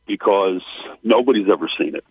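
ordinary delivery

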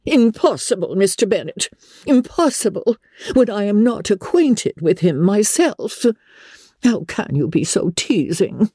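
high, flustered matron voice; exasperated mother. Acted. Wife refusing Mister Bennet's teasing suggestion, exasperated. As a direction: protest high-pitched, as to a teasing husband.

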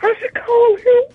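very high, slow, half-crying mock longing; pining ex-girlfriend. Pining over his phone like an ex-girlfriend, mock whine. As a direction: whimper like a lovesick ex. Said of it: "like it's an ex-girlfriend".

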